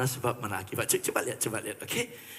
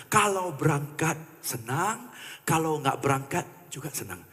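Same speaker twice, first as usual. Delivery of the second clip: usual speaking voice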